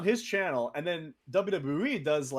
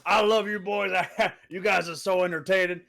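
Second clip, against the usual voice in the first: a bit higher and louder, hearty; imitating JBL; 4 semitones higher, 6 dB louder than usual. Retelling JBL's compliment, lightly voiced impression. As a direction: play the big friendly veteran. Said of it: "He said, he goes".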